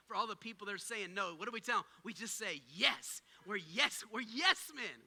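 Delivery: ordinary talk, nothing marked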